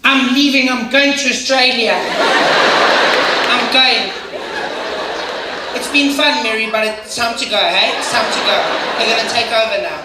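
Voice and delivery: a high, flustered goodbye to Mary; panicking emigrant. Retold or imitated. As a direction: flee to Australia in a fluster. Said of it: "you'd hear them".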